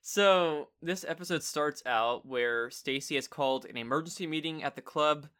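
ordinary delivery

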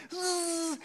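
long, high-pitched buzz; imitated line whizz. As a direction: imitate fishing line screaming off the reel. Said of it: "I heard my line just".

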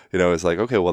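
ordinary talk, nothing marked

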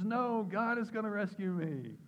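high, loud, drawn-out refusal; hammed-up drowning man calling back; acted stubborn refusal. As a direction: shout it back, stretched and utterly sure. Drowning man refusing help in story.

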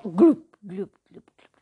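glug sounds fading into nothing; bubbling glugs that die away; gurgling sinking. Acted. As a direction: gurgle as you go under.

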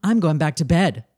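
brash taunting invitation; [name]. Acted. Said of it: "you're gonna play the role of Nick".

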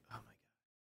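breathy stunned whisper; whispered oh my God. Retold or imitated. Friend retelling a car scene, stunned whisper. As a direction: mutter it under your breath in shock. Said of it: "I'm like".

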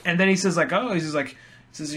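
ordinary talk

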